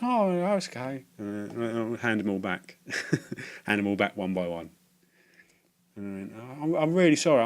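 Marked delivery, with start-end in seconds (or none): 0.0-1.0 put-on much higher, slow soothing old lady; old lady reassuring him, high and slow; sweet old lady. 6.4-7.4 higher, overdone regret; apologising again to the ladies, hammed up; hammed-up apology. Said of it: "really ham it up".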